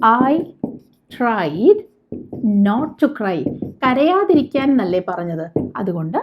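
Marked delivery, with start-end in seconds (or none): 0.1-3.6 drawn-out, word by word; slow model sentence. Shown as a demonstration. Teacher showing learners the English sentence, very slow. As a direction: dictate it slowly to the class. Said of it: "teacher models sentence".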